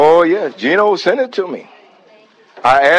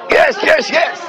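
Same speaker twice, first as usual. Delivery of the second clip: ecstatic praise; 11 semitones higher than usual. Real, not acted. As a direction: let the Spirit lift you into shouted praise.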